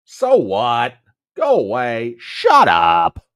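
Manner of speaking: loud, fed-up brush-off; annoyed shout of so what; dismissive retort. Retold or imitated. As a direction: tell them loudly to get lost. Voicing dismissive American public.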